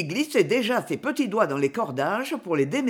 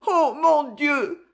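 higher, slow plaintive cry; plaintive moan; 7 semitones higher, 3 dB louder, much slower than usual. Acted. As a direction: moan it in distress. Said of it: "murmura-t-elle d'un ton dolent". (plainte dolente, lente et plus aiguë)

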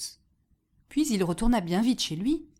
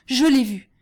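loud, raised report of a fright; loud horror, settling at the end; horrified exclamation; 4 semitones higher, 6 dB louder than usual. A narrator's reading. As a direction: cry out what you saw. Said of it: "s'écria le paysan en faisant un bond".

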